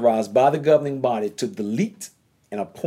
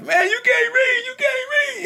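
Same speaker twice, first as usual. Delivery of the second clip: high, sing-song jeering at a failed reader; mocking taunt. Guys jeering at a man who failed the reading audition, mocking and high. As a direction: tease him in a high, shouted taunt.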